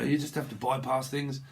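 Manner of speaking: ordinary talk, nothing marked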